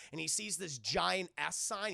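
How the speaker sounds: normal talking voice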